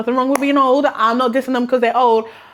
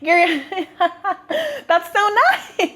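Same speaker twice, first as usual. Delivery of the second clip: high, slow, giggling politeness; squeaky mock-flattered giggle; nervous giggly teen self; 8 semitones higher than usual. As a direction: imitate a teenager giggling through awkward thanks. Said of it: "I was like".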